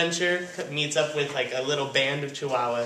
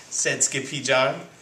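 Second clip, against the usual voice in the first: ordinary delivery, nothing marked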